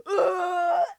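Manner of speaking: high, loud "ugh" of revulsion; short loud retch of disgust; loud disgusted groan. Real, not acted. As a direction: groan as if revolted. Disgusted at the quoted line.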